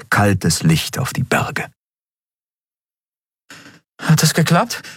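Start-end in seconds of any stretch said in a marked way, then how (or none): 4.0-4.8 high, tentative question; unsure. A narrator's reading. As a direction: ask it uncertainly in a raised pitch. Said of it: "fragte Endriel unsicher". (unsicher, mit hoher Stimme gefragt)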